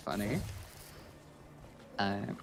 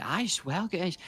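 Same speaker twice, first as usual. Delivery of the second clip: higher-pitched broad Scottish voice; thick Glasgow accent; 6 semitones higher than usual. Retold or imitated. Friend hearing about Iron Brew, higher thick Scottish. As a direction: imitate a friend in thick Glaswegian. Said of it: "she has a very thick accent".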